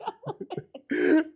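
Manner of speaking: high-pitched burst of real laughter. Real, not acted. Amused at his own shaving story, high laugh. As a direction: crack up, pitch shooting way up.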